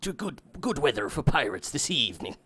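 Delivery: jokey put-on pirate voice; hammed-up pirate talk; pirate impression. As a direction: greet the crew like a comic pirate. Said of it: "Can you do a pirate?".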